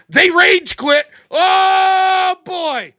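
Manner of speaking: loud, high crowing with a long drawn-out "oh"; hammed-up gloating shout; mock triumphant yell. Player pretends to crow after the moderator kicks him, mock triumphant yell.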